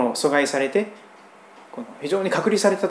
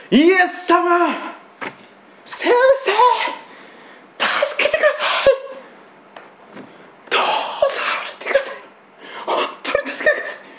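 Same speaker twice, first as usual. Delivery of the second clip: much higher, straining cry for help; desperate loud cry. Acted. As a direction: cry out desperately as if at the end of hope. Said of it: "声を張り上げてっていうのはそういうことです". (切羽詰まって声を張り上げる叫び)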